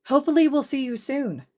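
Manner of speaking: high, bright-eyed chirp; high chirp as Uraraka says goodbye; chirpy Uraraka. Acted. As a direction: chirp it cheerfully. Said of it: "Uraraka chirped".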